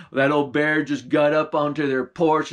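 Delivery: ordinary delivery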